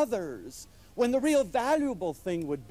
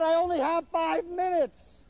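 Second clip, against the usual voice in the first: exasperated reminder; 10 semitones higher than usual. Retold or imitated. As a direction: remind her pleadingly of the clock. He insists on time.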